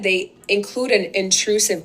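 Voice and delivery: ordinary delivery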